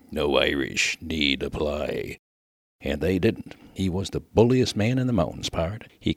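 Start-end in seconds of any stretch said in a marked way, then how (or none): 0.1-2.2 low, slow quoted saying; quoted motto. Retold or imitated. Miner quoting his late friend on guard, low and slow. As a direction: drop low and measure out the motto. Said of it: "Says he".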